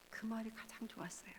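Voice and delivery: soft whispered confession. Retold or imitated. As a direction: breathe it out as a tender memory. Daughter's quiet confession.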